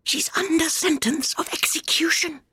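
anxious confidential whisper. A narrator's reading. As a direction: whisper a secret close to the ear. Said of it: "and whispered".

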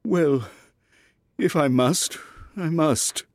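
high-voiced, reluctant king; resigned sigh of a royal voice; melancholy. A narrator's reading. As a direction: give in mournfully, with pauses. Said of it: "the king said with a melancholy air".

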